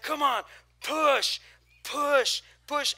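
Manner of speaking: mock trainer shouting push; trainer yelling. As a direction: yell him through the rep. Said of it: "literally yelling at me to push".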